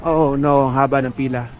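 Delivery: ordinary talk, nothing marked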